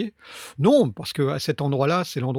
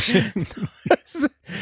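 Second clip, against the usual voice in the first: laughing; 7 semitones higher than usual. Real, not acted. Laugh after the joke.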